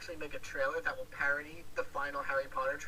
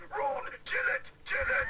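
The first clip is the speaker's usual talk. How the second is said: much higher, louder mock English accent shout as Vegeta; 8 dB louder, slower than usual. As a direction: yell it in a hammed-up English accent, Vegeta style. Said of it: "Vegeta, English accent".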